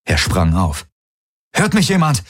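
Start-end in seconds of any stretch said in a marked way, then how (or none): 1.5-2.2 high-pitched urgent calling out. A narrator's reading. Galen calling into his comm during the attack, urgent call. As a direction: call out anxiously for any answer. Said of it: "rief er in sein Comm".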